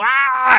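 loud, high drawn-out goal scream "aah"; long goal yell. Retold or imitated. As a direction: yell it like a striker celebrating a goal. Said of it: "fue como".

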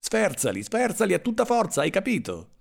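high-pitched, agitated command; frantic order. A narrator's reading. (ordine frenetico, in tono molto acuto)

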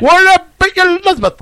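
high, loud Belfast greeting; Jim McDonald impression. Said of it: "do you want Jim McDonald?".

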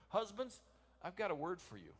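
ordinary talk, nothing marked

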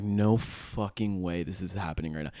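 low, soft retold disbelief; stunned disbelief. Reacting to the guard taking IDs, quiet stunned disbelief. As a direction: say it low and softly, as if to yourself. Recalled disbelief, under breath.

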